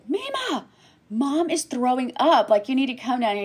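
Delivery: high, urgent mock kid's call; child calling out. Son calling grandma in alarm.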